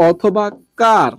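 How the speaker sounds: usual speaking voice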